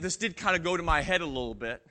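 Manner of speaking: usual speaking voice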